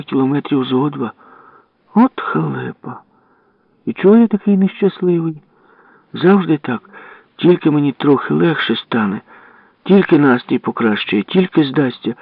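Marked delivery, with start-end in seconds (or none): 1.9-12.1 higher-pitched wail of self-pity; plaintive, raised-pitch complaint; self-pitying lament. Acted. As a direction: bemoan your rotten luck. Narrator laments his bad luck.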